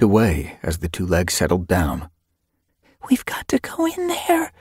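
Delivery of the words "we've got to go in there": high, shaky frightened whisper. A narrator's reading. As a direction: whisper it, trembling with fear. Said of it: "Graypool whispered shakily".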